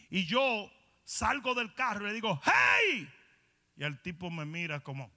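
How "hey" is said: high, loud call out; shouting hey at the man in the car; warning shout. Retold or imitated. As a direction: yell to grab his attention. Retold warning to young man.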